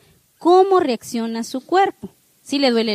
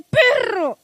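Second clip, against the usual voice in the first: yell at dog; 9 semitones higher than usual. Pretend. Scolding the dog with a broom.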